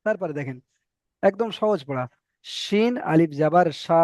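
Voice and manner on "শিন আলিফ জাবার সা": শিন আলিফ জাবার সা, slow with সা drawn out; long vowel reading. Shown as a demonstration. Teacher to students, drawing out the vowel. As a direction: stretch the সা one alif long. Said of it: "এটা টান দিয়ে পড়তে হবে".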